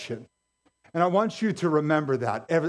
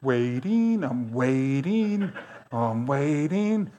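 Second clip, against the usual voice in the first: drawn-out, slow, patient sing-song; slow waiting; much slower than usual. Retold or imitated. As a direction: stretch each waiting as if killing time. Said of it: "Imagine that angel. He's just waiting".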